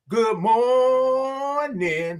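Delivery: high, long-held "mooornin'"; drawn-out greeting. Real, not acted. Host greets Queen on the stream, drawn out high. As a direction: hold the morning high and long. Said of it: "I love all those O's and R's".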